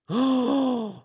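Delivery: high, drawn-out gasp of "oh"; shocked gasp. Real, not acted. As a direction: gasp at sudden gossip. Reacts to a startling chat rumour.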